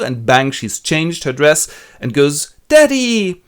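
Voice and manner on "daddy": high, loud squeal of joy; delighted cry. Acted. Daughter revealing herself to the Duke, joyful squeal. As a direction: squeal it like a girl running to her dad.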